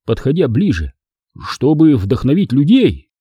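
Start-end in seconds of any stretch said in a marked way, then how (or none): none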